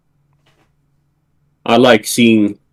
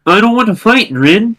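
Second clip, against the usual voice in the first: pleading; 8 semitones higher than usual. Acted. Character pleads to stop fighting.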